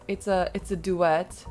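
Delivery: ordinary talk, nothing marked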